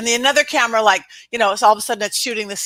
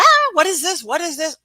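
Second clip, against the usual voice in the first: higher, startled "what is this"; panicked crowd; 6 semitones higher than usual. Retold or imitated. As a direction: sound spooked and confused. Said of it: "and everybody's like".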